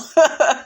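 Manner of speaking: short real burst of laughter. Real, not acted. Host laughing at reality show moment, short real laugh. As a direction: laugh at the story.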